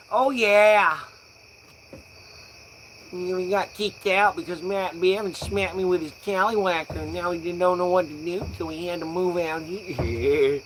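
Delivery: mock a chirpy Midwesterner; Midwestern folk accent. Stoner mocking Midwestern folk, hammed-up Midwestern accent. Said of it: "the Midwestern folk".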